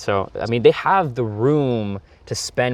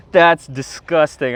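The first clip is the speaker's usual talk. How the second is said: disgusted; 5 semitones higher, 5 dB louder, much slower than usual. Real, not acted. Goo on his hands.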